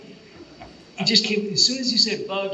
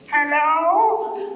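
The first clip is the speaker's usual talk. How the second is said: put-on high, louder quavering old man; 13 semitones higher, 6 dB louder than usual. Actor pranking the director on the phone, shaky old man. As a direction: sound like an ancient grandpa. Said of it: "I got on the phone and I went".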